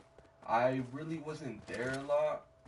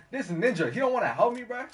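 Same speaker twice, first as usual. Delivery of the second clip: very high, loud acted outrage; mock indignation; 10 semitones higher, 8 dB louder than usual. Swimmer about lifeguard not helping him, loud mock indignation. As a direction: protest in disbelief at being abandoned.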